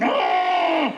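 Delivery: high, long-held mock cry; hammed-up pleasure wail; acted ecstatic scream. Acting own cry in sex.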